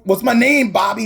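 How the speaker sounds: high, loud, bossy mock dominatrix; dominatrix demand. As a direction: bark it down at Bobby while slapping him. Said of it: "she starts dominating".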